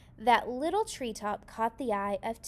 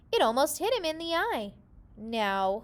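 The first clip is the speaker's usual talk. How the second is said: ordinary delivery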